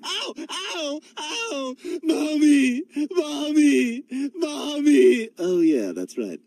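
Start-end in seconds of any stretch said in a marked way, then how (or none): 0.0-1.7 high-pitched yelps of pain; hurt child crying out; pained cries of ow. Acted. 2.0-5.3 loud, high wail; tearful, drawn-out calling; crying for mommy. Acted. Hurt child cries for mother.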